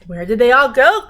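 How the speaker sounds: lightly shouted witch line. A narrator's reading. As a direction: shout it across the farmyard. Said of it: "Gritch shouted".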